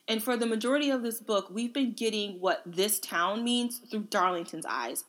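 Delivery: ordinary talk, nothing marked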